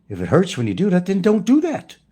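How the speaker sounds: high, loud mock-German doctor; lightly German-accented doctor, voice shooting up at the end. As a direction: put a hint of German on the doctor's blunt advice. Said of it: "Gotta put a German accent".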